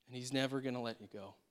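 ordinary talk, nothing marked

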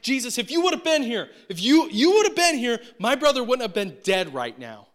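loud, high, anguished accusation; acted outburst of grief and blame; upset. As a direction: shout it in reproach, pitch soaring then dropping. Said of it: "And she's upset".

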